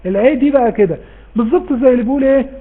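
normal talking voice

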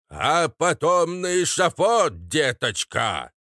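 loud, slow squawking parrot voice; cheeky parrot. Acted. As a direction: stretch it out like a mouthy parrot. (голосом наглого попугая)

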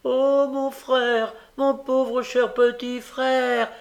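high, loud, drawn-out lament; tearful wail. A narrator's reading. Nancy playacts grief for her brother, wailing tearfully. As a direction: wail as if bursting into tears. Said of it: "s'écria Nancy fondant en larmes".

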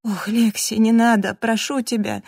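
wailing, imploring appeal; moaning plea. A narrator's reading. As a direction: beg in distress. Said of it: "простонал Джастин".

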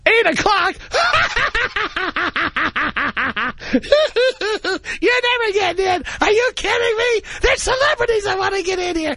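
high, cackling disbelief; mocking laughing clerk. Ticket clerk laughing at a newcomer wanting season tickets, high and cackling. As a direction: laugh the caller right off the phone. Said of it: "How many times we called Dolce".

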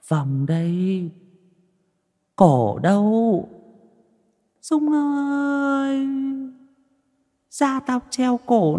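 slow, high-pitched ghost wail, vowels long drawn out; eerie drawn-out ghostly summons; ghostly shriek. A narrator's reading. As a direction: draw each call out high, like a haunting spirit. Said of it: "mà rít lên".

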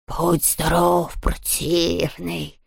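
slow, soft rustling ghost; rustling ghost whisper. A narrator's reading. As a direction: breathe it like a sly ghost. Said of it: "прошелестел Хуга". (шелестящий голос привидения)

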